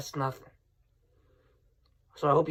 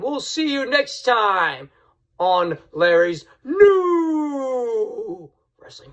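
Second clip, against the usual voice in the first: slow, drawn-out, louder and higher title call; announcer voice; 7 semitones higher, 4 dB louder, much slower than usual. Acted. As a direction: stretch it out like a ring announcer. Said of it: "sign-off with show title".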